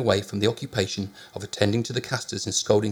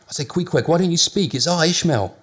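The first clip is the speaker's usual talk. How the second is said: calling out; 7 semitones higher, 4 dB louder than usual. A narrator's reading. Calling through locked door.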